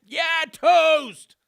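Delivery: sudden loud, high yell; song refrain shout. Acted. Host blurting the song's refrain at his cohost, loud shout. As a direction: belt out the refrain.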